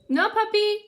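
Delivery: high, loud call to a puppy; scolding the dog. Real, not acted. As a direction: call out sharply to stop the dog. Calling out to dog getting in.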